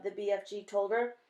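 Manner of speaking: plain reading voice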